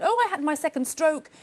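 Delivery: imitating a chirpy caller, pitch climbing high; bubbly. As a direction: retell it brightly as the bubbly caller. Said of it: "really bubbly and said".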